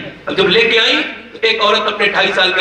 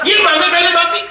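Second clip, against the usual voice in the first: raised-pitch mock squabbling; stubborn demand; 8 semitones higher than usual. First cleric demands the other apologise first, stubbornly. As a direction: insist in a high voice, imitating a quarreller.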